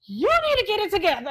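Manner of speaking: loud, high-pitched mock scolding; exasperated scolding. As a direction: yell it like a mother at her wits' end. Retold mother scolding hollering daughter.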